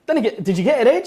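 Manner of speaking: high, loud, urgent questions; excited questioning. Retold or imitated. Quizzing the cyclist eagerly at the station. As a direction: press him for the number plate. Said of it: "I was like".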